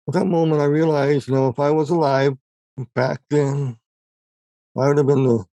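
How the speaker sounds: real choked-up voice, halting between phrases. Real, not acted. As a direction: hold back tears as you confess. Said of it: "a little choked up here".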